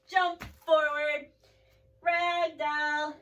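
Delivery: loud barked yoga commands; drill sergeant. Acted. Teacher as sergeant calling the sun salutation, loud commands. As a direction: call each pose like a sergeant. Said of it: "I'm your sergeant".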